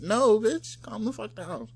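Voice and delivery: high-pitched, fed-up comeback; mock-outraged, high voice; exasperated refusal. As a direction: shut her down with comic exasperation. Acted reply to imagined [name].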